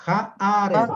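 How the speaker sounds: "Ja" held, pause, then "aret"; teacher's model of "Ja, aret", split by a brief pause; pausa dopo "Ja". Shown as a demonstration. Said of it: "sirve el acento para hacer una pausa".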